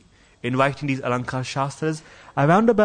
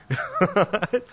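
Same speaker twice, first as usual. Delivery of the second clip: short, higher-pitched laugh; amused laugh; 5 semitones higher than usual. Real, not acted. Teacher amused by Nimai sending his rival home, laughing. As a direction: break into a brief laugh.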